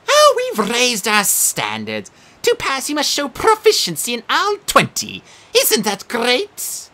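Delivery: high, slow, gushing cheer; game show host. Acted. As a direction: sell the bad news brightly. Sphinx as quiz host.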